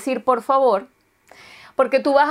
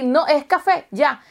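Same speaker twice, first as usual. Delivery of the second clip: usual speaking voice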